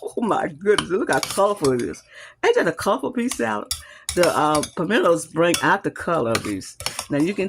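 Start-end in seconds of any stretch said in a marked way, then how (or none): 2.4-3.7 excited. Real, not acted. Admiring her finished salad.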